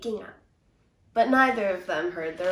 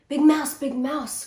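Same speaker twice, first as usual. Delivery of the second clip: calling out; 5 semitones higher, 5 dB louder, slower than usual. A narrator's reading. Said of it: "called Little Mouse".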